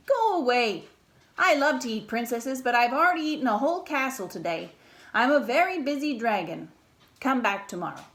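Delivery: loud, gruff dragon brushing her off; big busy dragon shooing the princess away; grumpy dragon. Acted. Dragon at the door shooing Elizabeth away, loud and grumpy.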